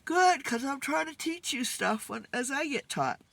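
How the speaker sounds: talking through a yawn. Real, not acted. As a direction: yawn while saying it. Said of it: "Excuse me for the yawn".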